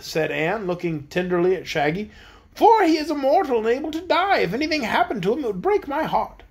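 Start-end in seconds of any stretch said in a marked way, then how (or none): 2.5-6.4 voiced high queen, fond and worried; lightly tender, high queen voice; high-pitched tender queen. A narrator's reading. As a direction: speak of him with fond worry. Anne speaks tenderly of Shaggy.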